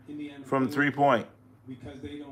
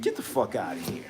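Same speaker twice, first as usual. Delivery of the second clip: low dismissive brush-off; 7 semitones lower, 10 dB quieter, faster than usual. Retold or imitated. Team rejecting the trade offer.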